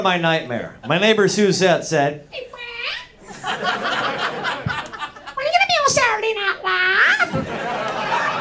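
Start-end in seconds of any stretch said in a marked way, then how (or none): 2.3-8.4 put-on southern accent. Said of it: "A southern accent".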